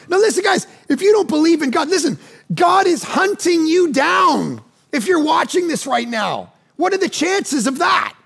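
fervent sermon voice; preaching at full force. Acted. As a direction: preach it hard to the congregation. Preacher's appeal.

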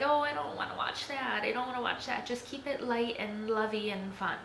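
mock reluctance. Viewer balking at heavy TV drama, lightly mock-reluctant. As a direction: imitate your own mild protest. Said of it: "sometimes I'm like".

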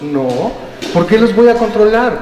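ordinary delivery

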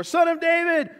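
high, loud, insistent shout; desperate plea shouted at full pitch. Acted. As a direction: cry out to be noticed over everyone. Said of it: "kept crying out all the more".

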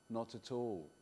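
ordinary delivery

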